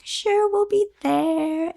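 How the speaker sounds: slow, high, dreamy yes; dreamy swoon. Pretend. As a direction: melt into the answer slowly. Swooning reply to Jihoo.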